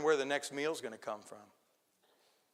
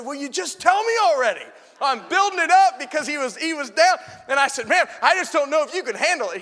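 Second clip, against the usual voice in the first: much higher and louder, fervent preaching; preaching at full force; 13 semitones higher, 15 dB louder than usual. Acted. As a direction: build the story to a shouting peak. Preaching climax, retold phone call.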